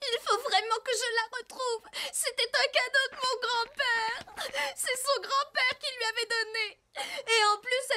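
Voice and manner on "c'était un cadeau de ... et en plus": tearful. Acted. Upset over losing grandfather's medal.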